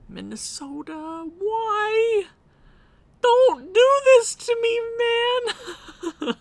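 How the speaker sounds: high, strained pleading wail; anguished plea. Real, not acted. Fan begging his team not to lose, high wailing plea.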